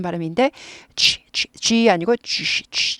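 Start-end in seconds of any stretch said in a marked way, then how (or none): 1.0-1.5 G whispered with no voice; just mouth the vowel of G; breathed G; voiceless G. Shown as a demonstration. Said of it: "발음을 내지 않아요". 2.4-3.0 G whispered with no voice; just mouth the vowel of G; breathed G; voiceless G. Shown as a demonstration. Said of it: "발음을 내지 않아요".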